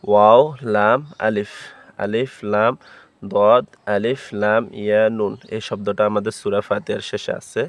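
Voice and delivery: normal talking voice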